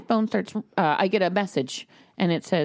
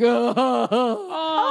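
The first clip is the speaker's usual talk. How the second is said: higher imitated child's wail; mock hysterical crying; 5 semitones higher than usual. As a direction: wail like a sobbing little boy. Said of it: "he's just crying hysterically".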